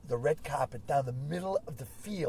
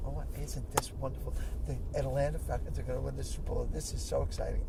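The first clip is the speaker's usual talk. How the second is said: prim lady; 4 semitones lower, 5 dB quieter than usual. Retold or imitated. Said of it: "so demure and so prim and proper".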